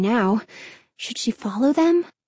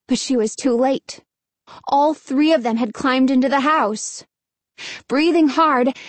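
usual speaking voice

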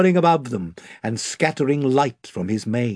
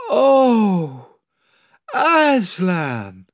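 higher, loud, crying out in despair; a heartbroken wail of his name; 7 semitones higher, 4 dB louder, much slower than usual. A narrator's reading. Edmund and Lucy cry out in despair at Aslan. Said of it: "in despairing voices".

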